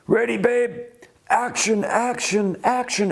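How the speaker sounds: excited call to action. Retold or imitated. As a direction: boss cueing the driver over the radio, pumped up. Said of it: "he says over the walkie-talkie".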